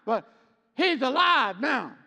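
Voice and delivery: high, loud, slowed proclamation; full-force preaching. Real, not acted. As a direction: ring it out from the pulpit.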